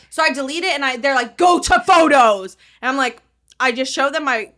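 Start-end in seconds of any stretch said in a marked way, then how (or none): 1.4-2.6 loud, higher, drawn-out command; mock barked order. Staff ordering her through her phone, mock yell. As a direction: imitate an order yelled slowly.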